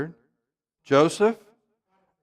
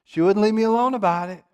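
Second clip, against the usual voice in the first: whiny excuse; 6 semitones higher than usual. Retold or imitated. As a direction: whine like a man blaming someone else. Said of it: "what a pitiful excuse".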